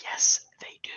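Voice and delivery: hushed whisper to the friend; whispered reply. Retold or imitated. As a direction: whisper it so the staff can't hear. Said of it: "I'm like".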